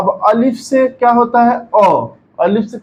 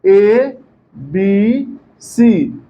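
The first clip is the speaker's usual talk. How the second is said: slow alphabet recital; much slower than usual. Shown as a demonstration. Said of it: "जैसे आप English पढ़े थे".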